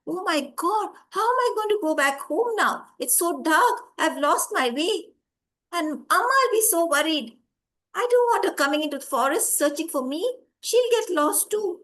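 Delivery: put-on worried boy. Lost boy thinks of his worried mother, acted fretting. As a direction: fret aloud as a lost child.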